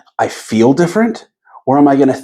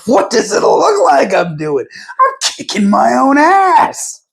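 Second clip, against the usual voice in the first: quoting the Liar Liar elevator meltdown; Jim Carrey in Liar Liar; 14 semitones higher, slower than usual. Retold or imitated. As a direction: howl it like a man beating himself up. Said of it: "he goes".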